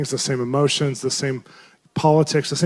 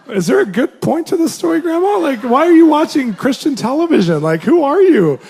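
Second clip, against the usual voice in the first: much higher, louder, pleading; exasperated; 10 semitones higher, 5 dB louder than usual. Retold or imitated. To grandma during her long story, high exasperated pleading. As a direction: beg her to get to the point.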